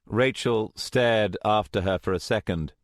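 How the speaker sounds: ordinary delivery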